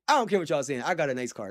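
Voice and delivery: ordinary delivery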